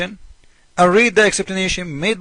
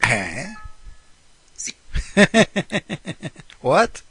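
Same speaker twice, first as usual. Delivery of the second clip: ordinary talk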